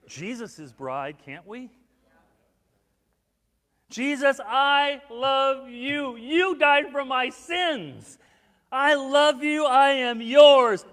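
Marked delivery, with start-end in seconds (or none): 3.9-4.4 slow, louder, heartfelt declaration; mock fervent devotion. Mock fervent prayer to Jesus. 4.4-6.1 slow, louder, drawn-out I love you; fervent devotion. Pretend. Said to Jesus in prayer. 6.1-10.8 slow, louder, heartfelt declaration; mock fervent devotion. Mock fervent prayer to Jesus.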